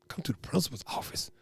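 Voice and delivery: very soft, low, voiceless echo; hushed disbelief. Retold or imitated. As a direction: repeat it under your breath, stunned. Stunned reaction to being called.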